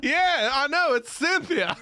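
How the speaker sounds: put-on high, loud camp American accent. Husband telling warlords about his wife, camp American accent. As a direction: whoop it in hammed-up Southern American. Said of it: "We're looking for American accent".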